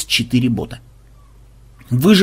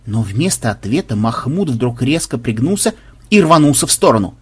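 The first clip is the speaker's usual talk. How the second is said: plain reading voice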